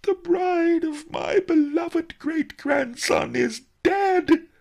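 loud, high, drawn-out lament; hammed-up wailing mourner; howling. A narrator's reading. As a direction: howl the terrible news in theatrical grief. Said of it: "howled Master Li".

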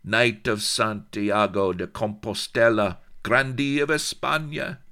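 high, loud proud declaration; high, loud accented noblewoman boasting her titles; haughty Spanish lady. Acted. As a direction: flaunt every title with fire. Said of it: "flamed the flashing-eyed beauty".

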